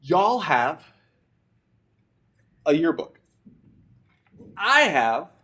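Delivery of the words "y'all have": usual speaking voice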